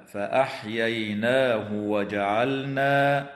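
slow recitation, short "hu" joining "فأحييناه" to "وجعلنا"; no silah. Shown as a demonstration. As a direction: leave the ه of "فأحييناه" unlengthened before "وجعلنا". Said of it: "فحكمها عدم الصلة". ("فأحييناه وجعلنا" بلا صلة لهاء الضمير)

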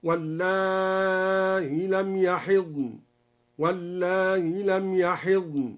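واللائي chanted with softened hamza, long then short; slow chant of واللائي, eased hamza long and short; slow recited variants. Shown as a demonstration. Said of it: "بحذف الياء وتسهيل الهمزة مع المد والقصر".

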